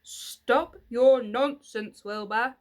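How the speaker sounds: put-on loud, slow old sheep shout. A narrator's reading. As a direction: bark it at the silly pig. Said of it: "shouted the oldest sheep".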